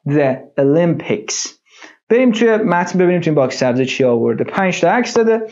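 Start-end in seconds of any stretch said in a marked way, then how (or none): 0.1-1.5 The Olympics said slowly and carefully, stress on LYM; slow, clear The Olympics for learners; slow model pronunciation. Shown as a demonstration. Teacher models the word for students, slow and clear. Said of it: "تلفظش چی شد؟".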